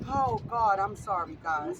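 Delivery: breaking down mid-speech, apologizing to the crowd; crying apology. Real, not acted. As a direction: say sorry while the tears take over. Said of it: "Don't apologize".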